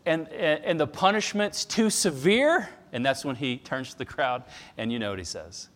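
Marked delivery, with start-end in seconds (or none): 0.1-2.7 higher, louder disbelieving question; incredulous quote. Retold or imitated. Pastor quoting Sproul's indignant question, higher and louder. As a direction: throw the question back in disbelief. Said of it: "He said".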